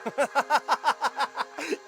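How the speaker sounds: high, loud burst of cackling; long, unhinged staccato laugh; manic cackle. Acted. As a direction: laugh like you've lost your mind. Deranged man laughs at her doom.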